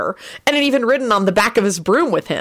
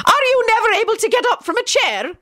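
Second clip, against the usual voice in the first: barked. A narrator's reading. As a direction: bark it as a sharp question. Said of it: "Minerva barked out".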